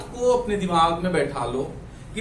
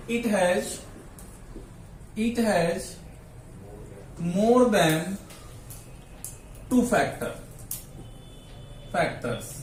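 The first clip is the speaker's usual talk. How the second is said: slow, piece by piece with long pauses; dictation; 3 semitones lower, 6 dB quieter, much slower than usual. Real, not acted. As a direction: dictate it slowly for note-taking. Dictates sentence for students to write.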